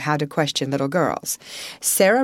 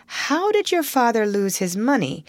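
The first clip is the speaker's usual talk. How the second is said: breathless; 8 semitones higher than usual. A narrator's reading. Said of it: "broke in breathlessly".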